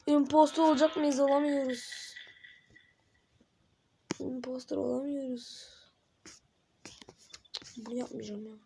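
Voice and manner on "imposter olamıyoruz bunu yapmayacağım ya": whispered under the breath; soft unvoiced grumble. Real, not acted. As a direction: hush it to yourself. Whispered aside while playing.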